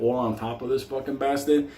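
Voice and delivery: normal talking voice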